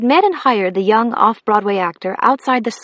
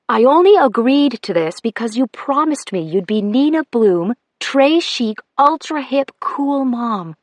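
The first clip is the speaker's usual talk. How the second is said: a hint of a teasing teen daughter, slightly higher; 3 semitones higher than usual. Teen daughter teasing her mom.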